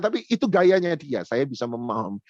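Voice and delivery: ordinary talk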